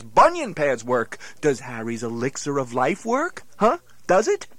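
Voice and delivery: ordinary talk, nothing marked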